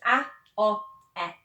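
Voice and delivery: a, o, e said as short pure Spanish vowels. Shown as a demonstration. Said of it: "So it's".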